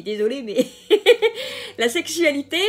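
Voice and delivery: real giggling. Real, not acted. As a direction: apologise while cracking up. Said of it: "je rigole parce que".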